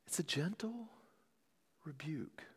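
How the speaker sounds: near-whispered, very soft; hushed. Real, not acted. Preacher naming the angels' rebuke, hushed. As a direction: drop almost to a whisper. Softly illustrates the gentle rebuke.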